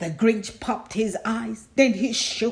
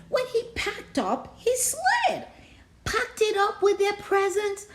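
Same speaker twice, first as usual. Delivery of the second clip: much higher, racing list ending in a shout; building excitement; 9 semitones higher than usual. A narrator's reading. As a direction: pile the loot higher and higher. Frantic list of loot.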